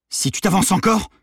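high, loud warning shout; yelled threat. A narrator's reading. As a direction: yell it to stop him advancing. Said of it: "hurla Maddy". (menace hurlée)

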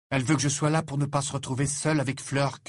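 plain reading voice

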